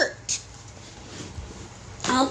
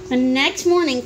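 ordinary delivery, nothing marked

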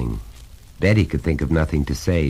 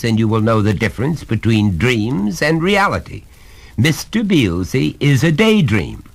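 higher, louder, hammering each point; stern father, pitched up and insistent; exasperated lecturing father; 8 semitones higher, 4 dB louder than usual. Acted. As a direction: lay down the law to a small son.

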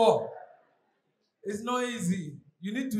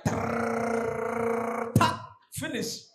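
ATM machine noise; much slower than usual. Retold or imitated. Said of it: "it comes like".

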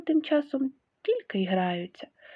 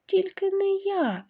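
high, slow squeaky mouse voice; squeaking; 11 semitones higher, slower than usual. Acted. Lazy mouse refusing to knead dough, slow squeak. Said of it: "пропищав Круть".

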